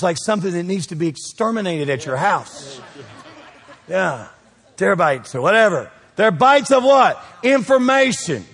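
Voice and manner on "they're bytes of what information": higher, louder push; rising preaching. Real, not acted. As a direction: build energy. Building preaching.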